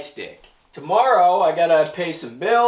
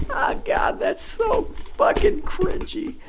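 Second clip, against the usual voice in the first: much higher, breathy laughing squeal; cringe-laugh at himself; slower than usual. Real, not acted. Laughing at his own bed joke to viewers, much higher squeal.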